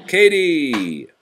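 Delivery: ordinary delivery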